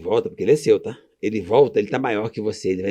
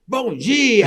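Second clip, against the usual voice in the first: bright, much higher Bom dia called out; cheerful greeting; 10 semitones higher than usual. Retold or imitated. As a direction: greet the whole lobby proudly. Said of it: "eu falo".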